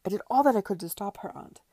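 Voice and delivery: fast, loud self-defence; crying out. A narrator's reading. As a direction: plead your innocence in a loud rush. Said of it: "cried Edward".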